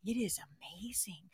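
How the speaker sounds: hushed, savouring the words; awed whisper. Real, not acted. Host confides the joy of living alone, whispering. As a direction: drop to a whisper, relishing it.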